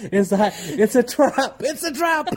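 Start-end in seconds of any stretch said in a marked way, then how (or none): 0.8-1.6 higher, laughing warning; mock alarm. Co-host joking about escaping marriage, laughing mock alarm. 1.6-2.3 very high, laughing squawk; shrieked mock alarm. Co-host joking about fleeing marriage, high mock alarm. Repeats warning, higher, laughing.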